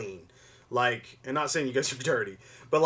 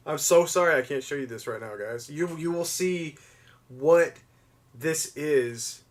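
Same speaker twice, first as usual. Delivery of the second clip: ordinary talk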